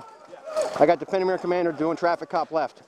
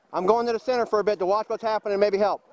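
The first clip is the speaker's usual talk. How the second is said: normal talking voice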